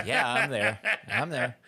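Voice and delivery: loud, laughing fake-cheerful yes; laughing mock agreement. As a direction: force a yes through laughter. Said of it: "bite your lip and say".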